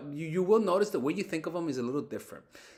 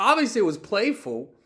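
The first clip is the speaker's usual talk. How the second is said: ordinary talk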